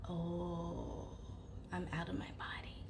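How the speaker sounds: unvoiced, fast whisper; hushed stunned realization; whisper to herself. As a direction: breathe it in shock to yourself. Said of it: "I'm saying to myself".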